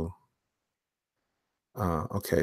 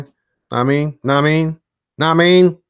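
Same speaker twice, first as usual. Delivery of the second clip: playful repeats, climbing higher and louder; building catchphrase; 8 semitones higher, 8 dB louder than usual. Pretend. Host riffing to viewers on a catchphrase, building louder. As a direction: push each repeat further up to a loud finish.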